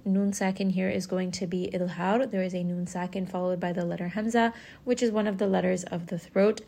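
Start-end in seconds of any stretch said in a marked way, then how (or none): none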